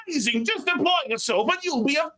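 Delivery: high, over-bright guru voice; mock an over-the-top motivational speaker; gushing motivational speaker. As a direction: parody of a cheesy hype speaker. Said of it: "speakers who are like".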